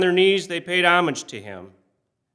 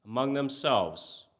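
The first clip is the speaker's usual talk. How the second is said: plain reading voice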